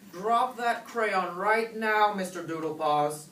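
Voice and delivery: stern command. A narrator's reading. Mama orders her kitten to drop the crayon, stern command. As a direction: drag out each word as a firm order. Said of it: "commanded Mama Junebug Jones".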